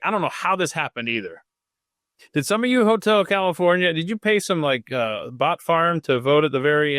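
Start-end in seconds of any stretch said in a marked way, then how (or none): none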